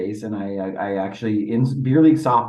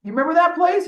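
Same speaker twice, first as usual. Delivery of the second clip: yelling to the dugout; 6 semitones higher than usual. Retold or imitated. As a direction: shout it over to your buddy on the bench. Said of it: "I yelled in my dugout".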